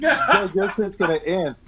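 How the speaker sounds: Doug called out high and loud, teasing. Real, not acted. Taunting friend Doug.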